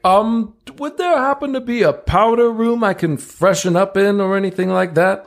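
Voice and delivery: high, fussy gentleman voice; prissy high voice. Acted. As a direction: ask it in a dainty high voice. Flint asks for powder room.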